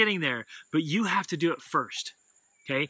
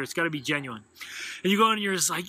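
ordinary talk, nothing marked

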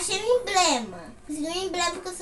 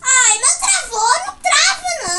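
higher, louder panicked plea; 8 semitones higher, 10 dB louder, slower than usual. Real, not acted. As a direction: beg the game not to freeze. Game freezing in panic. (em pânico)

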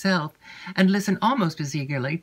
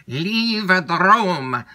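slow, loud, commanding bark; voice of thunder; 3 semitones higher, 6 dB louder, much slower than usual. A narrator's reading. Montoni ordering Emily out, slow thunderous command. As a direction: thunder it slowly down at her. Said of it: "in a voice of thunder".

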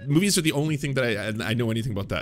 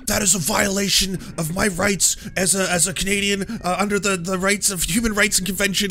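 higher-pitched indignant protest; flipping out; 6 semitones higher, 3 dB louder than usual. Retold or imitated. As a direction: protest as a blustering detainee. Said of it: "like, flipping out".

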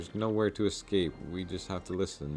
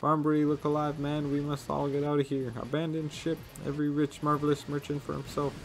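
higher, rising frantic orders; hammed-up panicked merchant; 7 semitones higher than usual. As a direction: pretend to stay calm, then flee first. Flavio panicking, high.

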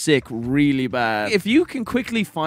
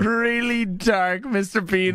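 Mister Bean impression; 5 semitones higher, slower than usual. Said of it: "fantastic impression".

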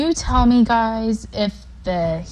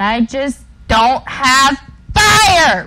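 louder playful shouting; screaming; 5 semitones higher, 5 dB louder than usual. Real, not acted. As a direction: shout it at the viewers. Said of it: "Am I screaming too loud".

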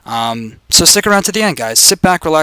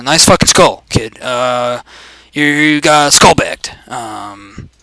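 slow, halting presidential voice; deliberate put-on speechmaker voice; mock politician taunting; much slower than usual. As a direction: stretch words and ums like a statesman. Obama-style voice during the fight.

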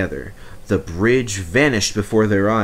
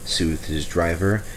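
plain reading voice

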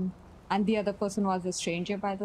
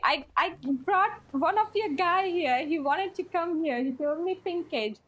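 high put-on South African accent; 6 semitones higher than usual. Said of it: "what's that accent? it's South African?".

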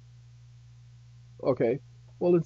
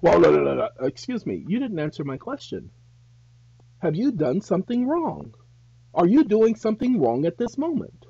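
mocking cop, higher and louder at the end; sarcastic officer; 5 semitones higher, 5 dB louder than usual. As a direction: ham up a snide officer. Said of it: "they're gonna get sarcastic again".

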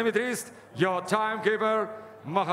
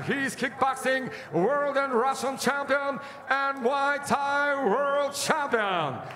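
arena announcer; 5 semitones higher, slower than usual. Real, not acted. Champion credentials announced.

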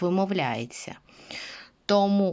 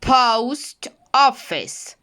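"Post office" very slowly, high and loud on "Post"; slow stressed model; 5 semitones higher, 5 dB louder, much slower than usual. Shown as a demonstration. Teacher drills the stressed o for students. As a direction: drag out "Post office", punching post. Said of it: "Post підкреслюємо".

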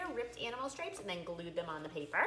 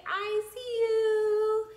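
high, slow and drawn out; playful peekaboo reveal; 13 semitones higher, 7 dB louder, much slower than usual. Acted. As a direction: sing it out like a peekaboo surprise.

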